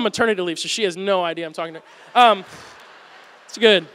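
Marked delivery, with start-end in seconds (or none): none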